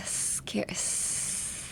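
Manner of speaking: SCARE softly, then a long hissed s; airy S. Shown as a demonstration. Teacher showing where the air comes out, soft airy hiss. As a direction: let the air hiss through the teeth on the S of scare. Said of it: "a lot of air is actually coming".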